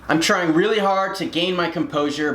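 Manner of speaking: ordinary delivery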